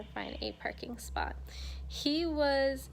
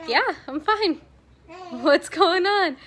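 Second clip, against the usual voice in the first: confused reply; 8 semitones higher, 10 dB louder than usual. Retold or imitated. Retelling confused reply to nurses.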